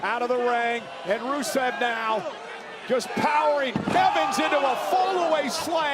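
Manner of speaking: excited high play-by-play shout; wrestling commentator. Real, not acted. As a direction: call the big move, thrilled.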